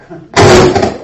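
high, loud burst of a laugh; jumped at the sudden noise; real startled shriek-laugh. Real, not acted. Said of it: "That scared me a little".

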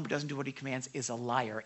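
ordinary delivery

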